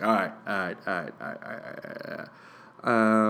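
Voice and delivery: retreating all rights fading out; fading brush-off. Retold or imitated. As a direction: back out of the conversation, trailing off. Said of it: "I was like".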